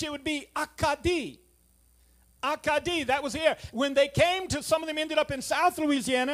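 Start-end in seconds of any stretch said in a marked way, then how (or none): 0.6-1.4 Acadie said the French way, ah-kah-DEE; French pronunciation. Shown as a demonstration. Preacher teaching the congregation the French name, ah-kah-DEE. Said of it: "In French". 2.4-3.0 Acadie said the French way, ah-kah-DEE; French pronunciation. Shown as a demonstration. Preacher teaching the congregation the French name, ah-kah-DEE. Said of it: "In French".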